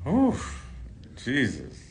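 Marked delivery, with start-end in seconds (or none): 0.1-0.7 a very high, sharp "oof" pushed out; overwhelmed exhale. Real, not acted. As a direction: catch your breath, overwhelmed. Overwhelmed with emotion.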